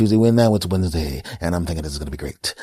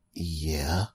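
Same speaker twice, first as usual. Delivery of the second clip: hesitant caller; 3 semitones lower, 6 dB quieter than usual. Pretend. Caller voiced quietly.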